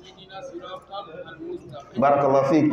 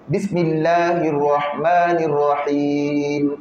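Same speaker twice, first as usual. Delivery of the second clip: slow, chanted tajwid recitation; Quran recitation; slower than usual. Acted. Teacher recites the Fatiha for students to follow. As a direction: recite the Fatiha slowly, melodically.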